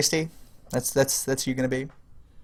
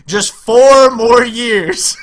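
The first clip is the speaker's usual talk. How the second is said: very slow, stretched-out words; slow drawn out; 5 semitones higher, 8 dB louder, much slower than usual. Real, not acted. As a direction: drag each word out teasingly. Drawn-out teasing warning.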